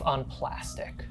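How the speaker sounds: hushed, breathy creepy description; whisper. Real, not acted. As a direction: whisper it like a horror tale. GM describing creepy scratching scene.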